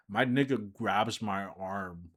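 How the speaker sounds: ordinary talk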